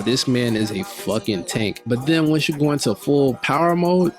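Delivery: ordinary delivery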